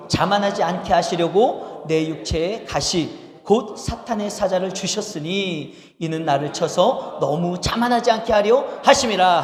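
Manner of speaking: slow, measured reading of the verse; slow unison Bible reading; scripture reading. Acted. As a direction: read it out slowly for the congregation. Congregation reads scripture aloud.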